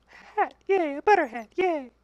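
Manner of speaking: high-pitched, squeaky little delight; tiny cheer. Pretend. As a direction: cheer softly in falsetto. Delighted at finding a hat.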